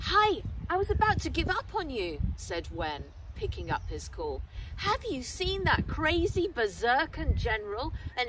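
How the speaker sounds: chatty schoolboy on the phone; young Wen. Acted. Wen answering Henry's late call, eager chatty boy.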